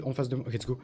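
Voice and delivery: normal talking voice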